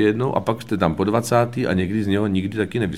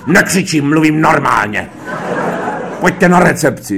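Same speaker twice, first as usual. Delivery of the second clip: loud, raised, emphatic denial; Krejčík, shouting; 6 semitones higher, 6 dB louder than usual. Retold or imitated. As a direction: insist at shouting volume. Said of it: "Proč na mě křičíte?".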